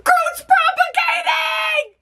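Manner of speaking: high, slow, drawn-out shout of joy; excited shout. Real, not acted. Seeing Groot regrow, a high excited shout. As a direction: shout it out in delight.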